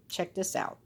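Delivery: soft aside to the listener; quiet aside. Real, not acted. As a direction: drop out of the story briefly.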